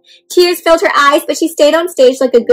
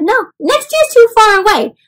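higher, louder insistence; eager insistence; 10 semitones higher, 4 dB louder than usual. Acted. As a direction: push back eagerly at mom. Child insisting to mom.

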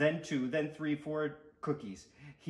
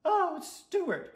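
high, louder dismayed wail; disappointed cry; 5 dB louder than usual. A narrator's reading. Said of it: "cried a disappointed mother and father".

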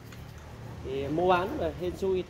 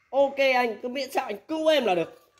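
higher, louder eager yes; eager customer; 7 semitones higher, 4 dB louder than usual. Retold or imitated. Desperate buyer accepts the rescue offer, eager and higher. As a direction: jump at the deal like a desperate buyer.

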